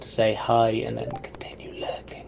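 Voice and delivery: whispered aside; whispering. Real, not acted. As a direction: whisper it to the lurkers. Unvoiced aside to lurkers.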